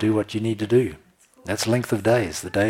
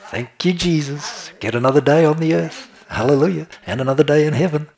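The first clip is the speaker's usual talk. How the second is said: higher, louder cry of thanks; joyful morning praise; 7 semitones higher, 6 dB louder than usual. Shown as a demonstration. Preacher shows the congregation how to celebrate each morning, in loud, high joyful praise. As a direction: shout your thanks to Jesus.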